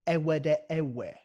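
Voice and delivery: slow, deliberate ewede ewe with open e; beatbox vowel drill. Shown as a demonstration.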